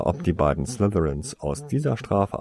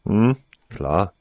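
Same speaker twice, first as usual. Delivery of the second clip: cynical; 5 dB louder than usual. Acted. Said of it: "dachte Tarsoin zynisch".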